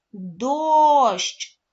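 Дощ called out loud, chin up; word said out loud. Shown as a demonstration. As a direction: sound out Дощ loudly for the class. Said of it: "говоримо повільно слово «дощ»". (голосно вимовляючи слово Дощ)

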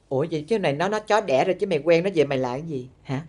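normal talking voice

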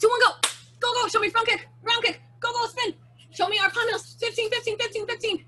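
high, urgent race calls; calling out. Real, not acted. Calling moves during the race.

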